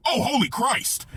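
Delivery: high, loud, drawn-out exclamation; mock appalled father's yell; imitated horrified shout. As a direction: cry out slowly in shock. Horrified father in booth, acted out.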